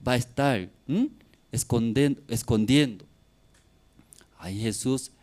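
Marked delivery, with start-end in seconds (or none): none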